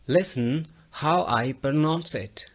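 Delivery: Listen how I pronounce it said slowly, each word clear; slow clear model. Shown as a demonstration. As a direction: spell it out slowly for the learners. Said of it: "आप मेरी आवाज़ पर गौर करें". (धीमे और साफ़ उच्चारण में)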